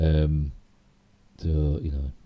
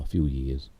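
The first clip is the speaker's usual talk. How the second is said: ordinary talk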